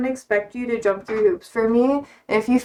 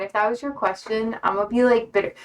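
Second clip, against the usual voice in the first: ordinary delivery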